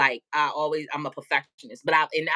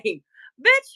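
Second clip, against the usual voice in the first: mock confrontation; 9 semitones higher than usual. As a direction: call her out for the whole store to hear.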